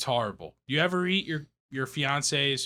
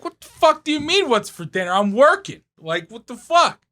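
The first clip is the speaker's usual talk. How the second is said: outraged high yell; 8 semitones higher, 7 dB louder than usual. Retold or imitated. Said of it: "It's like".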